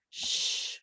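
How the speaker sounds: Sh as a soft unvoiced hiss; sh sound. Shown as a demonstration. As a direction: breathe out a long sh. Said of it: "What says sh?".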